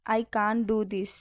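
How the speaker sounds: "I can't do this" said slowly, each word clear; slow it down for learners; slow, careful repeat. Shown as a demonstration. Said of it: "teacher repeats the phrase slower". (నెమ్మదిగా, స్పష్టంగా)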